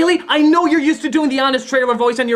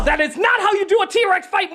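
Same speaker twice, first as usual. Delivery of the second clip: scolding; 8 semitones higher than usual. Acted. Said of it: "grabs them by the ear and says".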